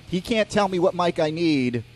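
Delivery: usual speaking voice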